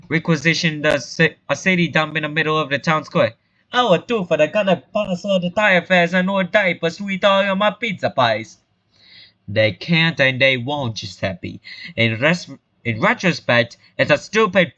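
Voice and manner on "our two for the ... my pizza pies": put-on higher, broad Italian pizzeria-owner accent. Giuseppe complains about his pizzeria, broad Italian accent. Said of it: "talk like an Italian stereotype".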